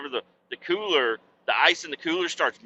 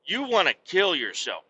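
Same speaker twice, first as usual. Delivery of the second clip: ordinary talk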